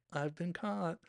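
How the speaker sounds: put-on sheepish kid who got busted; a hint of a child caught red-handed. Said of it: "like a kid".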